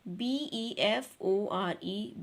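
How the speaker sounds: normal talking voice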